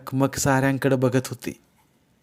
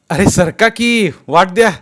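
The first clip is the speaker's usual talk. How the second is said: high, loud, drawn-out crowd-clearing shout; calling out; 8 semitones higher, 9 dB louder than usual. A narrator's reading. Sarpanch clearing a path through the temple crowd, loud call. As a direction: shout for people to make way.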